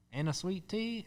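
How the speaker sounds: imitating a Southern waitress checking the order, pitched high; a mock Southern drawl on a quick question; Southern accent. Retelling a waitress in the South, a high mock Southern accent. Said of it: "just missed it in the accent".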